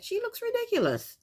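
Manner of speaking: high-pitched, scandalized disbelief; incredulous ridicule. Real, not acted. Vlogger ridicules a hideous outfit, high incredulous pitch. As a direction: voice shoots way up in mockery.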